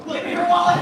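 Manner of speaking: barking a robber's demand; loud demand. Acted. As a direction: shout it like a mugger. Mugger demands wallet in skit.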